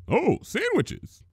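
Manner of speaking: high, slow happy exclamation; exclaiming. Acted. Spike exclaiming as the bell rings. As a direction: brighten up at lunch.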